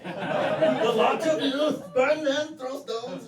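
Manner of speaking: high, loud laughing hellfire cry; mock zealot. Comic mocking heathens as a fanatic preacher, hammed-up. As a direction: ham up a pious fanatic.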